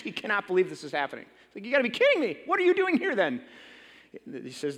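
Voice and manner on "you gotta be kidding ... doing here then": higher, indignant disbelief; a rich man fuming at the coachman; incensed. Acted. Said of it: "the rich man is just incensed".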